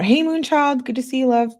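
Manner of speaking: a high, loud burst of hello to a friend who just arrived; light up and call out a welcome; excited greeting. Real, not acted. Breaks off to greet a viewer.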